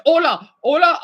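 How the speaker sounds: cheerful Spanish hello; Spanish greeting. Shown as a demonstration. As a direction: greet like a Spanish speaker, lifted. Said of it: "You'll be surprised when I talk in Spanish".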